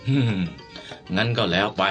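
low, grudging growl of assent; displeased master backing down; grudging relenting. Acted. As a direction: let it drop reluctantly, voice sinking. Angry master grudgingly lets it go.

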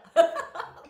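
sudden loud high laugh; burst of laughter. Real, not acted. Teacher laughing at herself about losing weight, a loud burst.

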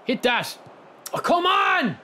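high frustrated shout; yelling from the sidelines. Real, not acted. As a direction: yell it at the screen in disbelief. Yelling at the missed chance.

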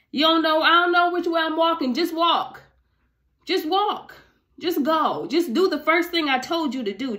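high, insistent voice of impatience; exasperated urging. Acted. God impatient with hesitant people, acted.

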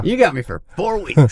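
ordinary delivery

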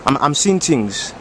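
put-on thick West African accent, easygoing; African uncle accent. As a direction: play a boastful African uncle. Same character voice, dazed.

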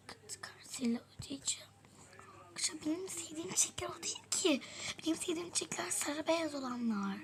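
soft hushed whisper. Real, not acted. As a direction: whisper aside to the camera. Secret whisper to camera.

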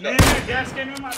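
loud, urgent callout mid-fight; urgent shout. Real, not acted. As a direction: yell it at your teammate. Teammate yells target mid-fight.

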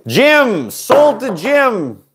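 high, slow call of the winning bidder; auctioneer call. Pretend. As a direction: drag out the sale like an auctioneer. Closing the auction sale.

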